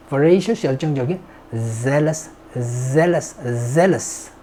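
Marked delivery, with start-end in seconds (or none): none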